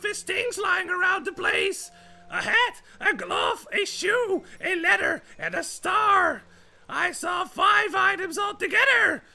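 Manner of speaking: very high, loud cartoon squeal; over-the-top panicked little creature; Toad. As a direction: shout it all in a frantic high-pitched voice. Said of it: "now we can talk to Toad".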